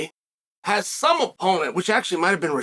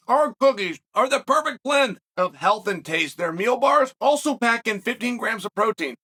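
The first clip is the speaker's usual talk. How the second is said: ad read; 5 semitones higher than usual. Acted. Reads sponsor ad.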